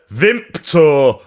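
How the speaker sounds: "Vimto" said as Vimpto. Retold or imitated. Flatmate insists, saying "Vimto" as Vimpto. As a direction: say "Vimto" with a slipped-in p. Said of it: "that's what I said".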